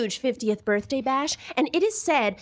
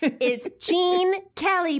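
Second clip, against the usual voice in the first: grand guest announcement; 6 semitones higher, slower than usual. Acted. Host introducing Gene Kelly, slow and grand. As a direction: present him like a showstopper.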